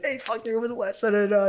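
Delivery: high, hyped-up friend texting; excited disbelief. Retold or imitated. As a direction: gush like a stunned fan. Friends' DMs voiced again.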